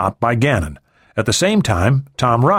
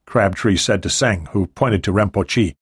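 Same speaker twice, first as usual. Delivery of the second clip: ordinary delivery, nothing marked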